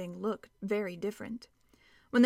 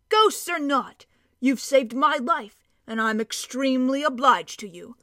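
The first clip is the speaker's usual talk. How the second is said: louder, lightly voiced stout dwarf; a hearty dwarf picking up the thread; 3 semitones higher, 6 dB louder than usual. A narrator's reading. Dwarf character voice.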